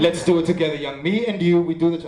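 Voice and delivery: imitating a friend's keen phone voice with an accent; mild impression of an eager friend's accent; Keenan's accent. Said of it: "that was a good accent".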